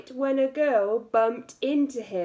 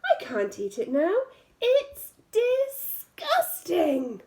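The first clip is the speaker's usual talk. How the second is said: high, slow, scornful disgusting; crow's mum; 6 semitones higher than usual. Acted. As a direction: caw out your disgust like an old crow. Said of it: "said the crow's mum".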